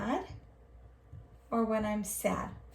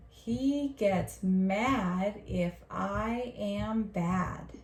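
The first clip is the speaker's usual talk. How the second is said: slow, stretched read-along for learners; much slower than usual. Shown as a demonstration. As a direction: model each word slowly and connectedly for the class. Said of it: "do it two times together".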